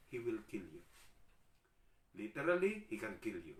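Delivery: ordinary talk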